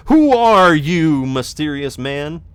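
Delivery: slow, loud theatrical question; dramatic. Pretend. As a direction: ham it up like a mystery. Dramatic mock question to merchant.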